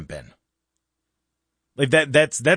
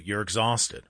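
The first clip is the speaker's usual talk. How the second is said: ordinary talk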